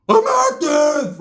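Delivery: high, drawn-out mock battle cry; hyped-up yell. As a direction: yell it long like a fired-up gangster. Ricky activates, yelling in story.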